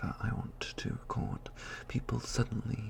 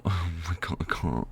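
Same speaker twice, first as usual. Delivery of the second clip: ordinary talk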